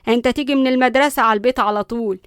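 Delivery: loud, bossy granny scolding; indignant grandmother, forceful; stern grandmother. A narrator's reading. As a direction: overrule them and lay down the law.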